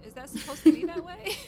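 loud laughing question. Real, not acted. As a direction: ask it through a laugh. Laughing at her quote.

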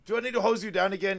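hammed-up threatening shout; mock scolding threat. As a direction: act out an exasperated warning, high and loud. Acts out character's threat.

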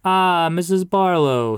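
hearty pastor greeting. Acted. Pastor greets the grieving grandmother outside church, hearty. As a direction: sing out her name like a beaming clergyman.

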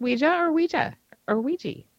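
Ouija with "jah" twice, then "jee"; comparing pronunciations. Shown as a demonstration. Host asking how it is pronounced, Ouija varied from "jah" to "jee". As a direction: offer Ouija as "wee-jah", finally as "wee-jee". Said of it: "is it pronounced".

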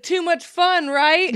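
laughing imitation of the customer; mock flirty customer. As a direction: tease like a customer who shares too much. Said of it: "She's like".

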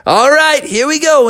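high, loud launch call; excited readiness. Acted. Froggy covered in pixie dust, high excited call. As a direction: announce it high and eager.

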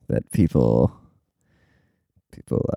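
ordinary talk

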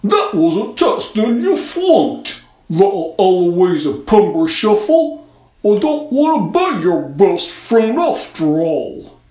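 loud, high, slow snowman scorn; scornful snowman. A narrator's reading. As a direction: reject her as a snowman. Snowman rejects girl.

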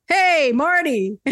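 mock greeting. Acted party greeting.

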